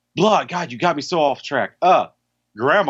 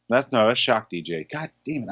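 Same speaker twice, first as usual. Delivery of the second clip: ordinary delivery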